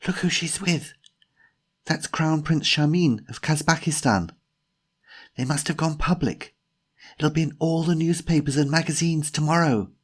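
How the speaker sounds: put-on high-pitched, excited young woman character; Jemima's urgent gossip. Jemima points out the prince to her companion, high urgent voice. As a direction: voice an agitated companion, pitched well up.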